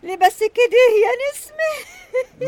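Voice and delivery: high, slow, tearful cry; tearful wail. Acted. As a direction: wail it out through tears. Lamenting sister, crying.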